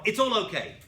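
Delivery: loud, bright it's-all-okay brush-off; hammed-up fake cheer; mock breezy dismissal. Shown as a demonstration. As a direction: brush it off far too cheerfully. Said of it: "you can't just jump from".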